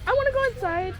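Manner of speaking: put-on high child voice; imitating child. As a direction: voice the little girl lightly. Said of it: "she just said".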